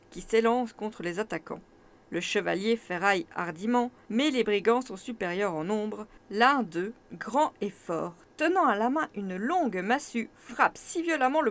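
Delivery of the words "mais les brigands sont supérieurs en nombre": ordinary delivery, nothing marked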